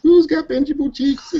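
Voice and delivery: put-on high squealing baby talk; squealing baby talk aunt. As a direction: coo like a doting aunt pinching cheeks. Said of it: "I was like".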